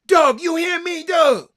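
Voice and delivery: high-pitched call for Doug's attention. Real, not acted. Calling out to Doug.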